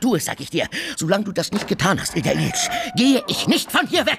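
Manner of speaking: high, fast, insistent shouting. Acted. Tarantjew shouting his demand at Oblomow. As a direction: hammer it in, rising to a high shout. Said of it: "Warum schreien Sie so?".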